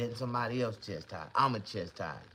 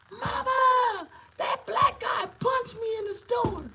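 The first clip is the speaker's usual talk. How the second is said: very high, loud, slow shrieking scream; a kid screaming to his mother in panic; hammed-up little boy's shriek, dragged out slowly; child's scream; 12 dB louder, slower than usual. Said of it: "he screamed".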